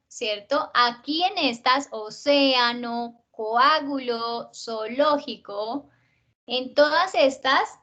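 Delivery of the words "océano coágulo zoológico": océano, coágulo, zoológico slowly, neighbouring vowels kept apart; syllable opened into hiatus. Shown as a demonstration. Teacher listing hiatus words, océano, coágulo, zoológico slowly separated. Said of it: "se abre la sílaba".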